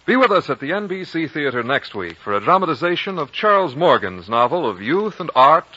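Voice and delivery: ordinary talk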